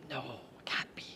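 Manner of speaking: whispered inner voice of reason; sensible inner voice. Retold or imitated. Her sensible inner voice whispering a doubt to herself. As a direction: hush yourself, talking yourself out of it. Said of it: "my sensible voice goes".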